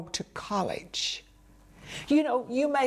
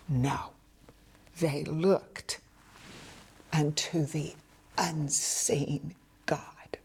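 whisper; 5 semitones lower, 4 dB quieter than usual. Real, not acted. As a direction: draw them in with a hush. Preacher's hushed climax.

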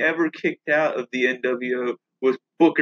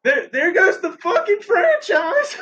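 crying; 12 semitones higher than usual. Real, not acted. Reacting to Stan Lee's death.

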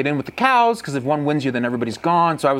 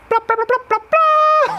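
very high, far-off call sounds; imitated cow call; 5 dB louder than usual. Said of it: "like, on the cow call".

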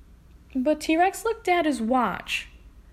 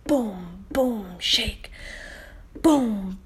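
plain reading voice

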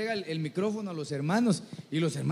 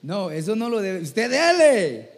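louder, drawn-out "go for it"; rousing urging; 5 dB louder than usual. Acted. Preacher cheering on a mistaken prophet, loud and drawn out. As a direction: egg them on with a long loud call. Urging her to keep going regardless.

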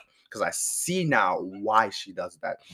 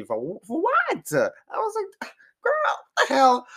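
high, slow, cracking cry of disbelief; a shocked, near-tearful high wail; stunned outcry; 5 dB louder, slower than usual. Retold or imitated. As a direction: wail it like you can't believe your ears. Stunned by aunt's nasty remark.

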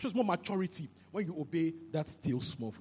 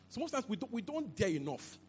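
ordinary talk

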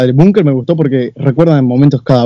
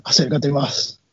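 softly drawn in, much softer; creepy soft come-closer; hushed lure; 10 dB quieter, much slower than usual. Retold or imitated. Retold killer luring the victim closer.

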